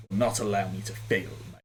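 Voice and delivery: quiet, fast brooding samurai; edgy anime voice. Acted. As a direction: mutter it like an edgy anime hero. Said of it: "accented with the edgy anime".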